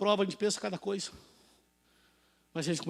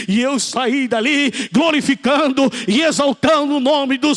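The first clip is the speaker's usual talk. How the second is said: very loud preaching, building higher to a climax; pregação exaltada; 6 semitones higher, 10 dB louder, slower than usual. Real, not acted. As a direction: rouse the church to glorify, stretching words. (pregação em alta voz, num crescendo)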